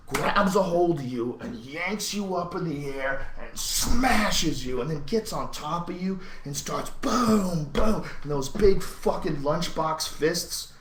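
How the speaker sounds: loud, high, drawn-out slams and booms; hyped-up ranting. Real, not acted. Hyping a fighter's brutal beating to listeners, fired-up ranting. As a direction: hammer out each blow, loud and slow.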